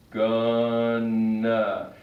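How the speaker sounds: gonna said as one blended word, going to reduced; casual gonna. Shown as a demonstration. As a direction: run going to together into gonna. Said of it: "Most English speakers say gonna".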